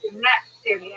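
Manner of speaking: ordinary delivery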